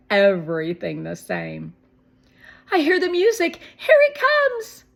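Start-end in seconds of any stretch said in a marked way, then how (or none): 2.7-4.9 very high, loud excited cry. A narrator's reading. Esme hearing the truck music, excited cry. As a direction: squeal it out, thrilled. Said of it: "cried Esme".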